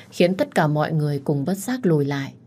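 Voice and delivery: ordinary delivery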